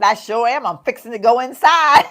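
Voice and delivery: playful Southern answer with a twang; Southern drawl. Retold or imitated. Answering a friend's teasing in a playful Southern accent. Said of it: "I said".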